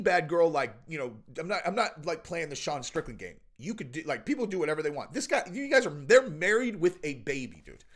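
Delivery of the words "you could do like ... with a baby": ordinary talk, nothing marked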